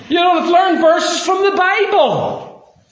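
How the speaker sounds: high, slow, loud exclamation; preaching shout. Real, not acted. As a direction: belt it out from the pulpit.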